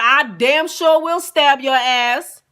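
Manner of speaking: high, loud, drawn-out retold threat; defiant yell. Woman answering the guest she is threatening, loudly defiant. Yelled answer in knife quarrel.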